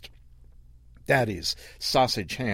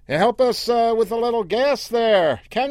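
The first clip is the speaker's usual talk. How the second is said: much higher, louder city driver calling out; 13 semitones higher, 6 dB louder than usual. Acted. Lost driver calling to Earl for gas, higher and louder. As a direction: hail the local from the car window.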